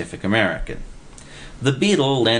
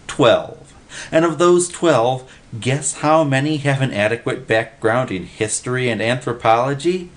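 higher, emphatic questioning; worked-up expert; 6 semitones higher than usual. A narrator's reading. Jones quizzes Dwindle on the scarce experts, worked up. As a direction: build the case with rhetorical punch. Jones rants about the cards.

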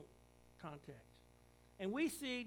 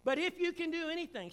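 "But if you can do anything" higher and louder, pleading; higher, louder plea of a father; desperate plea; 10 semitones higher, 9 dB louder than usual. Acted. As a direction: beg for help as the desperate father. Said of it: "provides him with an opportunity to ask for help".

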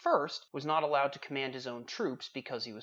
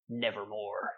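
low, much softer dread; ominous raven; 6 semitones lower, 7 dB quieter than usual. Acted. Raven's final answer to the poet, low and hushed. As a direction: breathe it out like doom. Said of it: "Quoth the raven".

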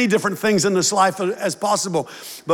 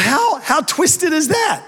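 incredulous; 8 semitones higher, slower than usual. Real, not acted. Preacher exclaims at devil's twist.

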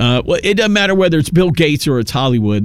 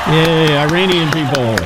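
sarcastic cheer; 2 semitones higher than usual. Real, not acted. Mock cheer at the speech.

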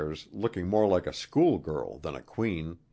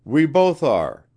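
firm answer; 9 semitones higher, 10 dB louder, slower than usual. A narrator's reading. Wilson answers the queen before crowd.

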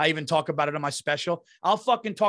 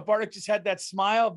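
normal talking voice

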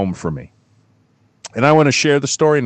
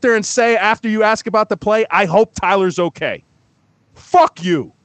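heated rant; 10 semitones higher than usual. Real, not acted. Venting anger at the coach.

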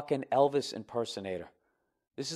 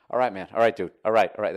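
louder, plain-guy voice replacing the act; dropping character; 7 dB louder, faster than usual. Acted. Fake [name] in a handshake fight, loudly dropping character. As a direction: let the impersonation fall away, punchy and firm. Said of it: "his voice is changing".

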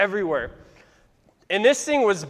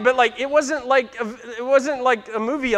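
usual speaking voice

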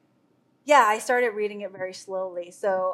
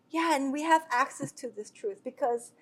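usual speaking voice

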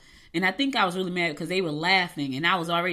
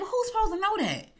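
exasperated retort; 10 semitones higher than usual. Retold or imitated. Retold indignant reply to staff.

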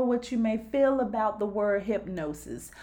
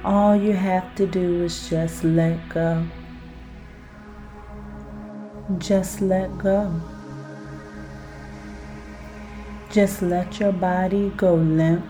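guided hypnosis; 4 semitones lower, 4 dB louder than usual. Acted. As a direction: lull the listener down into relaxation. Guided self-hypnosis exercise.

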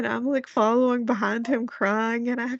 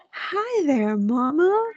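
put-on higher, sing-song toddler hello; little boy's greeting; 6 semitones higher, much slower than usual. Son climbing in with mom, imitated little-boy greeting. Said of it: "and he goes".

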